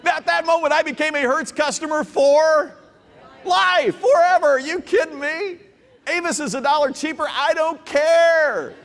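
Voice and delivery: hammed-up, shouting through laughter; excited. Enthusiastic loyal customer rant.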